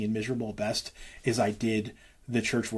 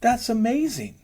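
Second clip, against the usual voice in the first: much higher and louder, delighted; excited; 12 semitones higher, 4 dB louder than usual. Real, not acted. Believer excitedly praising the gospel to his host. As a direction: burst out with joy about it. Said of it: "excitement about God".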